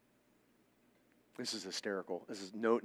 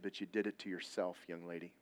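much softer, low, stern words; quiet firm rebuke; 4 semitones lower, 8 dB quieter than usual. Retold or imitated. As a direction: say it low and plainly. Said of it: "I said".

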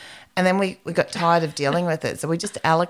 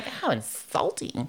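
mock offended; 7 dB quieter, slower than usual. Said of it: "we, like, were going".

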